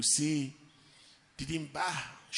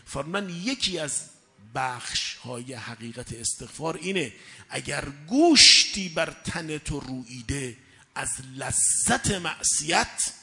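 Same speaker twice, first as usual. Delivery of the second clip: ordinary talk, nothing marked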